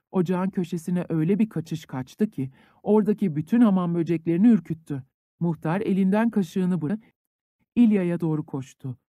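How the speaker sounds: ordinary delivery, nothing marked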